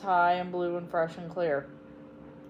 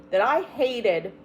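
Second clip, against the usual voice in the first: slow, bitter words; angry; 3 semitones higher, 5 dB louder, slower than usual. Acted. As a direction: let the anger bite through. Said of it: "Samantha's voice sound so angry".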